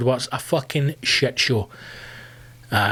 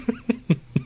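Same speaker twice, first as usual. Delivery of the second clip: real laughter; 9 semitones higher than usual. Real, not acted. Laughing at own story.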